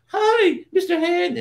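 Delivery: very high, loud little clay-figure voice; Mister Bill voice. Retold or imitated. Guest imitates Mister Bill greeting his tormentor, very high and loud. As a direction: squeak it out like the helpless clay man. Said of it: "he talked like that voice".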